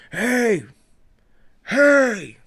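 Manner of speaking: loud high call; loud call to get someone's attention. Retold or imitated. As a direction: yell it across the street. Calling out across the street.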